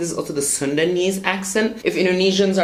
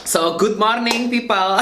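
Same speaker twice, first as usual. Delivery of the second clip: higher-pitched big-city Indonesian accent; Javanese-mixed Indonesian accent; 5 semitones higher than usual. Shown as a demonstration. Vlogger greeting viewers in a demo accent, Javanese-mixed Indonesian accent. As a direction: start in a street-slang Javanese accent, about to crack up. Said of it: "the big city Javanese mixed accent".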